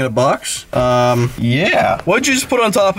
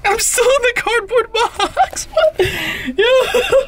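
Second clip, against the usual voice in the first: laughing; slower than usual. Real, not acted. Giggling inside the box.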